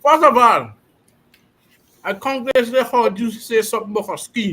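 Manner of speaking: talking with mouth full. Real, not acted. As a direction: talk through a mouthful of food. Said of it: "talk with your mouth full".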